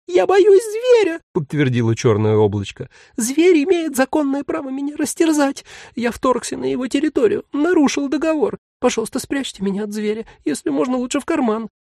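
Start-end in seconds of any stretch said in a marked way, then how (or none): 0.1-1.2 tiny frightened voice. Acted. Cloud confirms fear. 3.2-11.7 plaintive tiny voice. Acted. Tiny demon pleads.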